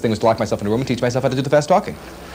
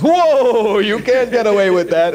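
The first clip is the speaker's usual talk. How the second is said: high, louder mock alarm; the brain catching the slip; the brain scolding him for a mispronunciation, high mock alarm; inner voice alarm; 10 semitones higher, 4 dB louder, much slower than usual. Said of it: "the brain goes".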